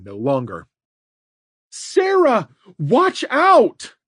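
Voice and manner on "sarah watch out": high, loud warning shout. A narrator's reading. Mother shouting a warning to her daughter, loud shout. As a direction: yell a sudden warning across the yard. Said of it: "reacted faster to her shout".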